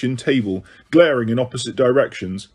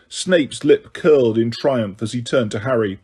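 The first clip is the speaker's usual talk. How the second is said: ordinary delivery, nothing marked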